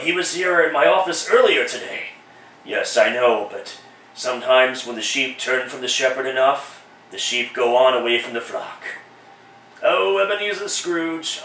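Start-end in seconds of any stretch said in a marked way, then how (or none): 2.6-8.8 lower, solemn spirit voice; lightly voiced solemn ghost; low ghost of present. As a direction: preach the parable as the spirit.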